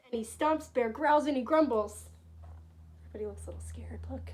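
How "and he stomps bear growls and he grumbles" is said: loud. A narrator's reading. Angry bear stomps.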